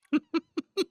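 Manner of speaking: sudden high, loud burst of laughter; real squeaky laugh; high giggle. Real, not acted. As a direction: cackle at your own pun. Laughs at own joke.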